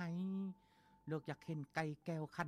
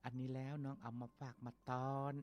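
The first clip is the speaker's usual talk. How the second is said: put-on tender girl's voice; 4 semitones higher, 3 dB quieter than usual. Girl gives gifts to orphan.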